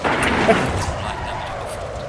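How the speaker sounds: a quiet airy chuckle; breathy laugh. Real, not acted. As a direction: laugh softly under the breath. After a lucky move in race.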